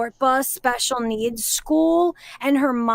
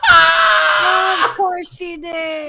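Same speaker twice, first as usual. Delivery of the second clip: slow, loud shout through laughter; laughing shout; 6 semitones higher, 6 dB louder, much slower than usual. Real, not acted. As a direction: bellow it while still laughing.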